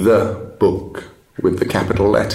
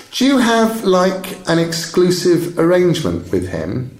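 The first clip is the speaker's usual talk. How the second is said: put-on higher-pitched teenage girl, direct questioning; lightly voiced inquisitive schoolgirl; 10 semitones higher than usual. Schoolgirl interrogating bookseller about his trade, lightly voiced, high and inquisitive. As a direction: lightly play a shrewd girl quizzing a trader.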